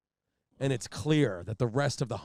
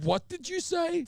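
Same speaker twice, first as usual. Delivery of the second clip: incredulous demand; 3 dB louder, slower than usual. Retold or imitated. Trooper reacts to the confession.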